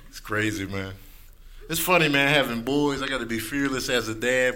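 ordinary talk, nothing marked

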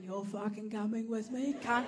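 low, quiet mock growl to the straps; hushed, gritted threat to a strap; under-breath snarl at the straps; muttered threat. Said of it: "I went".

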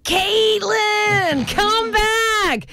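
high-pitched, drawn-out mock yell in a Canadian accent; imitated intense yell. Nineties rock god yelling after the embarrassed cousin, imitated intense yell. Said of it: "He yells out in his intense, um, Canadian accent".

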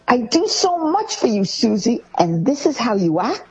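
high, wounded reproach; guilt-tripping parent. Retold or imitated. Parent guilt-tripping Susie, high and reproachful. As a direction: sound hurt and let down.

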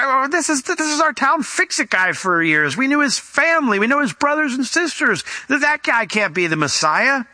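high, mocking hometown crowd; a hint of sneering neighbours; scoffing townsfolk. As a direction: scoff at the local fix-it guy.

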